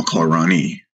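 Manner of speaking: plain reading voice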